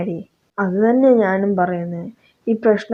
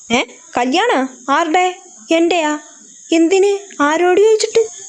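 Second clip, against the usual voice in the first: outraged disbelief building; shocked protest; 8 semitones higher than usual. Acted. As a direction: son shocked at sudden wedding plan, rising disbelief. Son shocked by marriage news.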